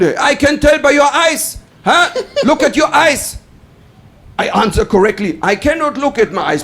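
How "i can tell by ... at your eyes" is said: high, slow accusing shout; acted officer's raised-voice interrogation; shouting customs officer. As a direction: press the suspect like a stern officer. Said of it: "he shouted at me".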